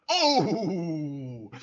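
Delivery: delighted high exclamation; gleeful oh. Real, not acted. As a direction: relish the hit out loud. Gleeful reaction to hit.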